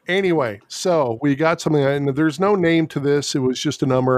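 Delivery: ordinary talk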